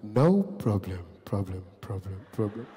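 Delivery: deep voice with fading mouth-made echo; echoing rich man. Retold or imitated. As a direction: repeat the last word low, trailing away like an echo. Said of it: "His voice comes in echo too".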